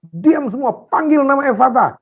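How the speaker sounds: high, urgent angry command; retold angry orders. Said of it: "saya marah".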